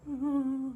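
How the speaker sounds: high, loud whimpering hum; crying monster. Retold or imitated. The crying monster whimpering over his new mate, high and loud. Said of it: "He's like".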